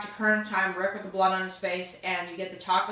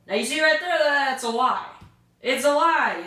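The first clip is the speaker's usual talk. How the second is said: higher and louder, indignant; outraged call-out; 7 semitones higher, 4 dB louder than usual. Real, not acted. Reviewing the episode, loudly calling out the villain's lie.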